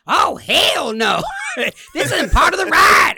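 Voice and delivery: high, loud outraged shout with a laugh; loud woman yelling. Retold or imitated. Dad imitating a stranded woman, loud outraged shout. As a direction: belt it like a furious stranded rider. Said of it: "I heard this loud woman yell".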